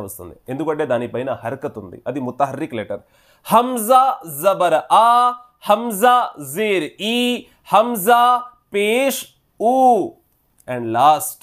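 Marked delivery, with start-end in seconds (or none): 3.5-5.1 slow, higher, louder chant, rising on ఆ; drawn out for students to repeat; recited drill. Shown as a demonstration. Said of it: "డైరెక్ట్గా చదివి నేను వినిపిస్తాను". 5.7-9.8 slow, drawn-out chant that rises on each vowel mark; qaida recitation drill. Shown as a demonstration. Teacher recites hamza drill.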